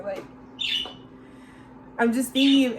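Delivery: ordinary talk, nothing marked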